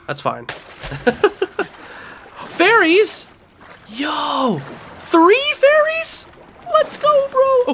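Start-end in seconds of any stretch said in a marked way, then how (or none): 2.3-7.7 very high, squealing excitement; thrilled disbelief. Real, not acted. Gamer stumbling on three fairies, thrilled squeal.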